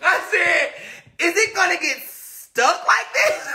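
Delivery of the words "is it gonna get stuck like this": higher, laughing as she asks; high laughing worry. Retold or imitated. Asking viewers if her thumb stays stuck, laughing. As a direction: worry and giggle at once.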